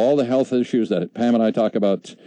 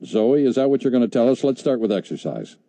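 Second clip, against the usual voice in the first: usual speaking voice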